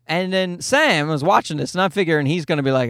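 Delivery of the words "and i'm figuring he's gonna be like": normal talking voice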